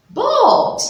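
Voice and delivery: Boat called out high and loud; emphatic reading. Shown as a demonstration. Teacher revealing the new word to children, high and loud. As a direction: pronounce Boat as the answer. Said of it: "We go".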